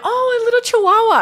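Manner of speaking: put-on high, loud baby-talk squeal; cooing at a cute dog. Coming home, cooing at the little dog. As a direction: coo it like you just spotted a puppy. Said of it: "And I'm like".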